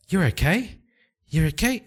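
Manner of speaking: high, rising, amazed he's alive; relieved surprise. Acted. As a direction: gasp it out, relieved and stunned. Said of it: "Leda looks at him and goes".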